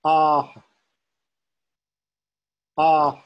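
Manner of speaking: loud "Ah" with a short breath echo after it. Shown as a demonstration. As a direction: chant "Ah", letting the vowel echo on the out-breath. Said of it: "there's that echo".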